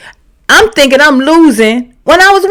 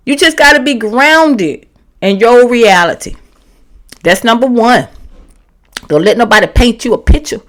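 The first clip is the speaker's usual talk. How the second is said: normal talking voice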